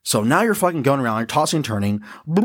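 ordinary delivery

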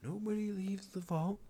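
high-pitched, incredulous retelling; mock disbelief. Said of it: "And you're like".

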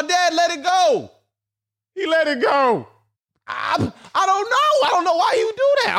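high, whiny fake sob; hammed-up crying over a fish; mock crying.

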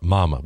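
Mama said as a drawled Texas mama, low. Shown as a demonstration. A salesman showing his Texas mama. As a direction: say Mama the Texas way, drawn out and dropping. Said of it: "We talk like that in Texas".

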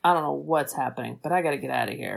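low, soft, quick mumble of a rattled bunny; scared Easter Bunny. Retold or imitated. Bunny to Jack, trying to escape, low hurried murmur. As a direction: shrink away and hurry the words out, deep and hushed. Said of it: "the Easter Bunny is like".

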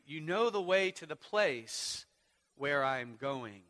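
full-force sermon reading; preaching the scripture. Acted. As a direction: proclaim the promise to the church.

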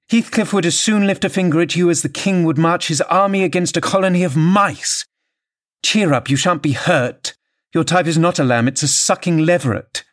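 high-pitched mocking taunt; scornful wife, taunting. A narrator's reading. Wife taunting husband before Heathcliff, scornful. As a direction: jeer at him as harmless prey.

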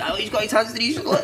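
laughing quote. Retold or imitated. Mocking teammate's antics, laughing.

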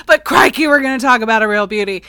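put-on a playful stab at an Australian accent, loud; mock Australian accent, quick and loud; bad Australian accent. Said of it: "I won't do a bad Australian accent".

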